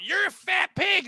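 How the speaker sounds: high, slow taunt shouted across the classroom; a bratty kid calling out; mock kid yell. Kid taunting a classmate named Rude, mock yell. Kid yelling insult in story.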